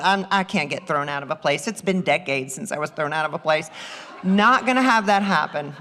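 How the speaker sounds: laughing through her own words. Real, not acted. Mom laughing at herself for almost dashing past the boy. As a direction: chuckle while talking yourself out of it.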